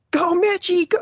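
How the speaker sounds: very high, squealed mock cheer; imitated yelling. Sisters and mom yelling at his hockey games, high mock cheer. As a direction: imitate family yelling from the stands at no real volume. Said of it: "they would be yelling".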